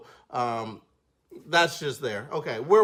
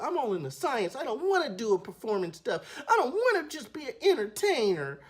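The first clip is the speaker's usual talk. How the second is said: put-on higher whiny girl voice; earnest little girl; 8 semitones higher than usual. Little girl refusing to perform, higher whiny voice. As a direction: insist you're a scientist. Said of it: "she was like".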